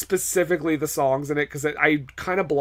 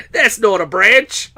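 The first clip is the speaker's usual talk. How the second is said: slow, louder put-on Aussie drawl; mock Crocodile Dundee; 4 semitones higher, 6 dB louder, slower than usual. Host riffing Paul Hogan as Groot, slow mock Aussie. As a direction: deliver the knife line as a tree. Riff on knife line as Groot.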